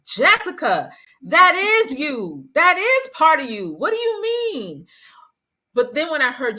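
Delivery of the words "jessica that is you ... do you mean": high-pitched yell at the TV, re-enacted; exasperated yelling. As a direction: act out pleading loudly with someone on screen, much higher. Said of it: "I was screaming at the television".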